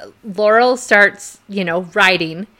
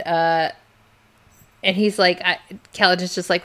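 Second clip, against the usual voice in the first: ordinary talk, nothing marked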